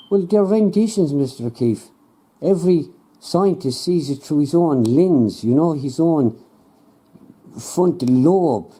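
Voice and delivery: lightly voiced know-it-all scientist; smug scientist. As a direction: explain it slowly to a doubting farmer. Scientist defends renditions.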